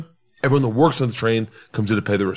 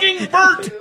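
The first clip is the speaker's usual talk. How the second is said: high squeaky laugh through the words. Real, not acted. Caught out by a friend, bursting into high laughter.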